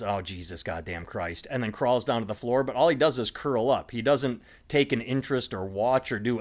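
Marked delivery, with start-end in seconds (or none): 0.0-1.5 a grumbling dog, lower and softer; a lightly voiced, weary dog groaning under its breath; annoyed dog. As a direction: mutter it like a fed-up dog leaving the bed. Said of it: "he sort of looks at us and goes".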